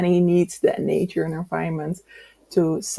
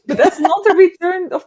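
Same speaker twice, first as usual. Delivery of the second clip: high, laughing protest; 11 semitones higher, 7 dB louder than usual. Real, not acted. Host laughing to co-host over mixed-up books, amused. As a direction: laugh as you question it.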